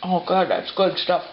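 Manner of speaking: savoring with mouth full; chewing and talking at once. Real, not acted. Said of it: "I'm still talking with my mouth".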